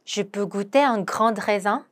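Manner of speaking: very slow, careful French; slow pronunciation model; slow demo. Shown as a demonstration. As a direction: lay out each syllable slowly for learners. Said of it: "한 번 더".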